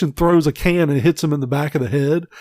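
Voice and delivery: ordinary delivery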